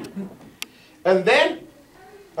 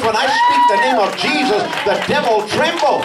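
preaching at full force; 9 semitones higher than usual. Acted. Preacher shouting his testimony to the church, full force. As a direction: proclaim it to the congregation with fire.

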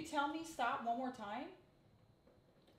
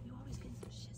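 hushed whispered scolding; whispering; 6 dB quieter than usual. Acted. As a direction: whisper it so others can't hear. Scolding quietly in front of people.

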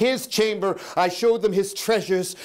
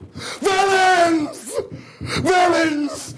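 shrieked; 11 semitones higher, much slower than usual. Acted. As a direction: shriek it at them. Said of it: "I shrieked".

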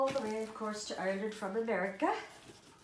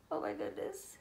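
breathless gasp; 9 semitones higher, 6 dB quieter than usual. Real, not acted. Gasp at the doll's hairband.

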